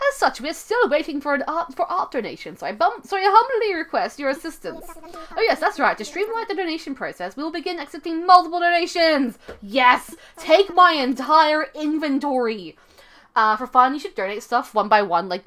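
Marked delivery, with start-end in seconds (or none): 0.1-9.4 higher-pitched, lilting British owl voice; British accent, swooping up and down. Acted. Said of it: "give him some kind of British accent". 9.6-12.8 loud, drawn-out triumphant cry; hammed-up eager shout; gleeful exclamation. Player cheers the multiple-donation option.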